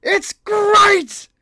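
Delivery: very high, loud squeal; hammed-up delighted squeal; squealing joy.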